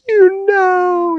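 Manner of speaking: very high imitation wail; hammed-up cartoon sobbing voice; mock bawling. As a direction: squeal it like a character bursting into fake tears. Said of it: "she starts just bawling".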